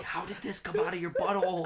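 high, loud, giggling question; laughing disbelief. Retold or imitated. Uncle retelling his shock, high and laughing. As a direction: gasp it out laughing. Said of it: "I was like".

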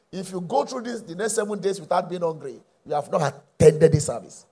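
ordinary talk, nothing marked

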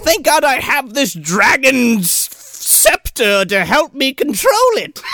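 high, slow, drawn-out villain voice; milk every word like a crazed doctor; gloating mad scientist. Retold or imitated. Doctor praising his dragon's scepter, hammed-up mad scientist.

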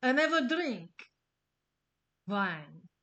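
put-on an understated Hungarian vampire accent, not melodramatic; Lugosi Dracula accent. Host delivering the most memorable Dracula line in a mock Hungarian accent. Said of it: "Just go with me on my shitty accent".

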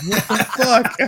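high, laughing outburst of mock betrayal; laughing through a shocked curse; betrayed, laughing. Friend retells being fooled, laughing mock outrage. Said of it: "I felt, like, betrayed".

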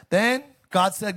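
ordinary talk, nothing marked

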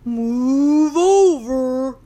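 loud, slow mooing command; long drawn-out cow moo on the first word; bossy cow order. A narrator's reading. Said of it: "uttered Cow".

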